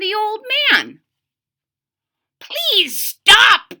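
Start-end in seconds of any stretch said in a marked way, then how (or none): 2.4-3.7 put-on high, loud pleading old-man voice; crying old man. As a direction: beg him to stop like an ancient man. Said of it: "cried the old man".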